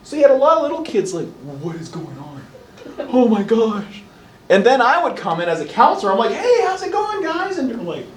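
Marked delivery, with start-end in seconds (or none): 1.6-4.0 overwhelmed little kid, hammed up; mock shell-shocked. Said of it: "little tiny shell-shocked kids". 6.3-7.6 much higher, sing-out greeting; friendly kid greeting. Acted. Counselor coming in to greet little kids, high friendly voice.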